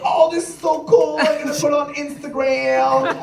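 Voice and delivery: put-on high-pitched, sing-on-one-note teen voice; gushing teenager. Teenagers see the captain, mock gushing teen voice. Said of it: "All the teenagers like".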